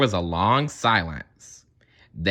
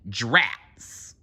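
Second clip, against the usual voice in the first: usual speaking voice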